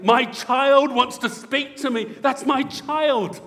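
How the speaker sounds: high, very loud proud-father exclamation; thrilled, booming announcement; excited. Acted. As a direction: burst out with delight, stretching the words. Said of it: "our God is so excited".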